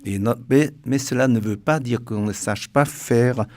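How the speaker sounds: ordinary delivery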